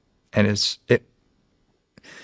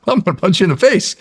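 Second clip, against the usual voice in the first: laughing through a higher, louder mock threat; 9 semitones higher, 4 dB louder than usual. Real, not acted. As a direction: crack up mid-threat.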